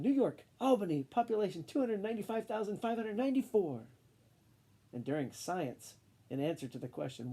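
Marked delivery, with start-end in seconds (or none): 0.0-3.9 interrupting know-it-all. Acted. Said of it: "Camilla interrupted".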